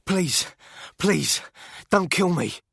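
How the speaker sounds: high, loud, panicked stammer; stammering plea. A narrator's reading. Beaten man stammers to the gunman for mercy, high and loud. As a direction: stumble over each please in terror. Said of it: "The guy stammered".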